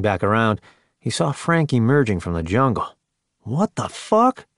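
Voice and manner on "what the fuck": high-pitched, drawn-out disbelief; exasperated, slow and high; indignant demand. A narrator's reading. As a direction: demand an explanation, pitch leaping up. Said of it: "Tex demanded".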